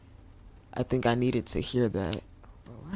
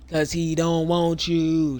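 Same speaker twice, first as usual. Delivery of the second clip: slow, loud and higher, every word spelled out; blunt verdict; 5 semitones higher, 9 dB louder, slower than usual. Real, not acted. Streamer answers the crying passenger with a slow, loud put-down. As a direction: lay it on her slowly and loudly.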